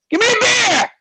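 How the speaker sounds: high, loud voice; mocking customer in a high voice; high squeaky barfly. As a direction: squeak the order. Mimicking guy's high voice.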